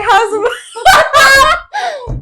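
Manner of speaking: loud, high, laughing blurt; high-pitched shout breaking into laughter; full-chested blurt. Real, not acted. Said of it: "With your whole chest too".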